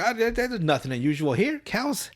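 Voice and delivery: a lightly voiced, breezy cop brushing it off; dismissive cop. Lazy cop telling the rancher nothing is unusual, lightly voiced. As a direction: wave it away like a lazy cop. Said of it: "It's like".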